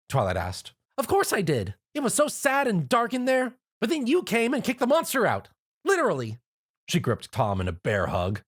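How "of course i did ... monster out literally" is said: much higher, bouncy, eager voice; bubbly party pony. Acted. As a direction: gush at the hero you just met. Narrator voices Pinkie Pie.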